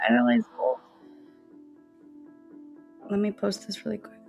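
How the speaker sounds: ordinary talk, nothing marked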